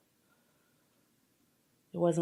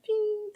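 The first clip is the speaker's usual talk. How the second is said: high bright "Ding" like a sparkle sound; ping it like a shining smile. Retold or imitated. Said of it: "I want to be like".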